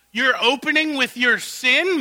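ordinary delivery

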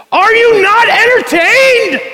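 huge, high, slow furious roar at the crowd; Russell Crowe shout. Retold or imitated. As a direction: bellow it across the arena like a gladiator. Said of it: "he screams".

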